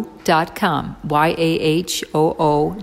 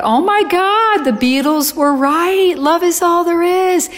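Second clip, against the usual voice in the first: high, loud cry of revelation; drawn-out, high-pitched exclamation of joyful amazement; ecstatic yelling; 12 semitones higher, 7 dB louder than usual. Retold or imitated. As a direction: shout it out in re-enacted ecstasy. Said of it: "I'm laughing and I'm crying and I'm yelling".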